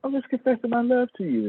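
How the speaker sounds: high, puzzled voice of himself confessing; innocent confused self. Retold or imitated. Comic pitching his innocent confession to an angry friend. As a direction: play it baffled and hurt, voice up high. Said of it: "And I'm like".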